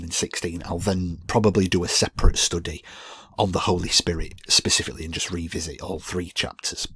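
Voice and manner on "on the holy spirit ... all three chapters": usual speaking voice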